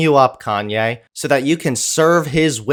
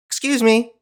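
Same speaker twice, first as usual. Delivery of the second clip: mock polite question; 7 semitones higher than usual. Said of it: "a simple question is asked".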